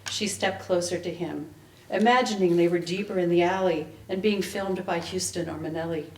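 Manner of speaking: usual speaking voice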